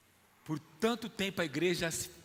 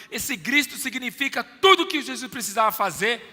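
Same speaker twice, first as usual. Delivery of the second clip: ordinary talk, nothing marked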